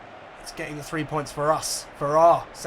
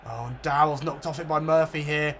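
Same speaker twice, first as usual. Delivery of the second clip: usual speaking voice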